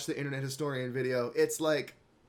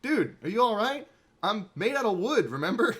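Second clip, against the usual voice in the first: a touch higher and louder, mock concern; lightly voiced fake Josuke; 7 semitones higher, 4 dB louder than usual. Retelling the manga, lightly voiced puzzled double. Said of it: "is like".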